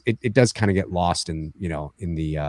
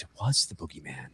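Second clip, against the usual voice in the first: quiet line; 6 semitones lower, 13 dB quieter than usual. Retold or imitated. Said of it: "and she says".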